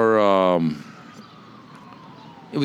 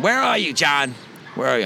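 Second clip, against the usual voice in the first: mother calling out; 6 semitones higher than usual. Retold or imitated. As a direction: bark it out like an angry mom hunting her kid. Mother storming in, calling son.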